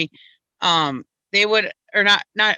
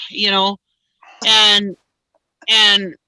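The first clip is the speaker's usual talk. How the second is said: ordinary talk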